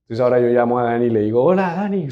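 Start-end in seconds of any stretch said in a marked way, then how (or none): 1.4-2.1 lightly put-on caller voice, pitched higher; a hint of a mimicked loved one; Gabriela. Scammer phones posing as Gabriela, lightly imitated voice. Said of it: "pero con voz de Gabriela".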